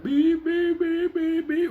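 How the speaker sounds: high monotone "beep" on a steady pulse; alarm clock beeping. Retold or imitated. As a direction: mimic an alarm clock, one even pitch. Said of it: "like a fucking alarm clock".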